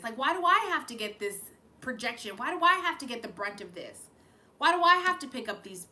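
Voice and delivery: channeling someone's angry, pleading protest; pissed-off venting. Acted. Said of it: "what you're feeling is pissed".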